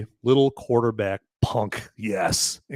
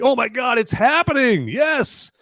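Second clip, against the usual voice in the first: high-pitched thrilled cry; excited outburst; 12 semitones higher, 4 dB louder, slower than usual. Acted. Reacting to a viewer's space sequel idea, high excited yell. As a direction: erupt with over-the-top excitement.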